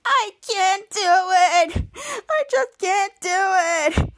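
high, slow, drawn-out fake sobbing; mock crying wail. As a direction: howl it in hammed-up tears. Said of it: "then Miles starts to cry".

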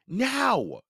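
no arguing; boy ordering sister; firm boy. A narrator's reading. Firm command.

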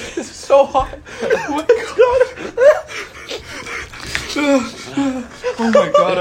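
high, squealing spice pain; burning mouth from spice. Real, not acted.

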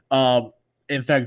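ordinary talk

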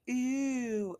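high, loud drawn-out "ew"; disgusted squeal. Retold or imitated. Acting the bully's disgusted reaction.

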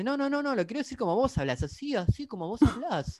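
mocking Shatner. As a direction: parrot him back in a high, taunting voice. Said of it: "Y dice".

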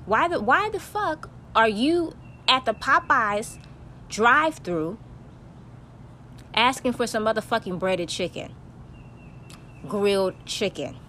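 ordinary talk, nothing marked

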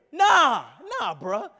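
loud, high mock street-guy challenge; hammed-up bystander calling Peter out; campfire accuser. Voices man confronting Peter at campfire.

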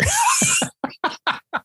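burst of laughter. Real, not acted. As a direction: crack up at the punchline. Laughing at his own story.

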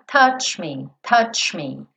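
"Touch me" with tch running straight into me, no vowel. Shown as a demonstration. Teacher drills the linking. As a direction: join "Touch me" as tatchmi. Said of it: "você já vai daqui tch, pro M".

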